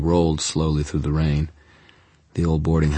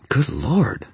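gasped; 8 semitones higher than usual. A narrator's reading. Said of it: "Susan gasped".

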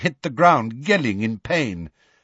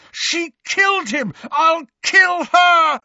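high furious cry, building louder; bellow; 5 dB louder than usual. A narrator's reading. Harry vowing revenge on Bellatrix, bellowing. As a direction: drag out the threat at full force. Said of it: "bellowed Harry".

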